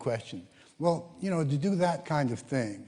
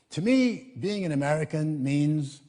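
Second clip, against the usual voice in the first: high, lifted TO ME then an open pause; asking the question; 4 semitones higher, 4 dB louder than usual. Retold or imitated. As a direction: pose the question like a reporter's prompt.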